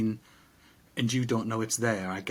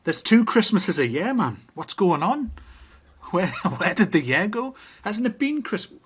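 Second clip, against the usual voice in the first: loud, high-pitched exasperation at time flying; baffled, hammed-up protest; mock disbelief; 7 semitones higher, 5 dB louder than usual. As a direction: exclaim it in comic bewilderment, pitch climbing.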